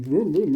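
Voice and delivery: playful vroom vroom of a stock car; engine vroom. Pretend. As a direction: rev it like a car on the track. Imitating a NASCAR engine.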